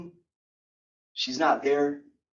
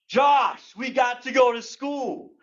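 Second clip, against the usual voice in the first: high, loud call on the name; imitated mom's yell; 8 semitones higher, 3 dB louder, slower than usual. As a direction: holler like a mom at her kid. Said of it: "she would yell my name".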